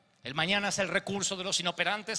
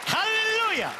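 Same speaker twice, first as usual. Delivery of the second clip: a preacher's ringing hallelujah; jubilant shout; 14 semitones higher, 5 dB louder, slower than usual. Real, not acted. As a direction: shout it high and loud, full of joy. Preacher exclaims to congregation. (grito jubiloso de predicador)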